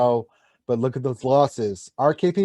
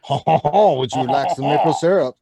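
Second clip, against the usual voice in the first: put-on French Canadian accent; 4 dB louder than usual. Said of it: "I can't do the French Canadian voice".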